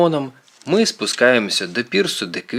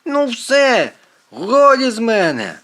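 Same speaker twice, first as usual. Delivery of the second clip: high, exasperated outburst; fed-up cry, pitch way up; shouting; 12 semitones higher, 4 dB louder, slower than usual. A narrator's reading. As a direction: yell that you are fed up. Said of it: "Квінт починає кричати".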